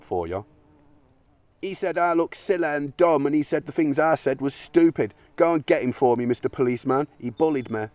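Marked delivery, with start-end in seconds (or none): 1.6-7.9 put-on much higher childish whine, a little louder; whiny tattletale. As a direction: tattle to the policeman like a sulky child. Mocking tattletale to policeman.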